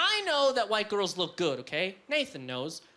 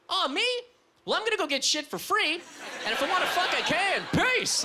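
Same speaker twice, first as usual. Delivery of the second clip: mock girl's reply; 7 semitones higher than usual. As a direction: brag it like a carefree girl. Girl on a date voiced.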